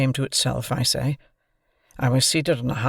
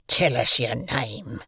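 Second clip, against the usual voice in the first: put-on loom over the boy and growl it; menacing convict; slower than usual. A narrator's reading.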